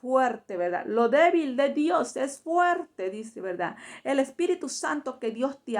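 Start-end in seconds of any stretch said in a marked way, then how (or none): 0.9-3.1 slow proclamation. Acted. Preacher proclaims a scripture line.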